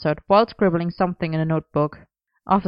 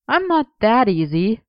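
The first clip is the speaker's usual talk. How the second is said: light teasing, a bit higher; 5 semitones higher than usual. A narrator's reading. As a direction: playfully fend off his boyfriend. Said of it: "he teased".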